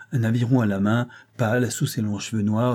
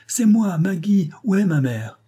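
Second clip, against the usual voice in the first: high, loud urgent answer; calling up; 9 semitones higher, 4 dB louder than usual. A narrator's reading. Maggie answering Tom from her boat, high urgent call.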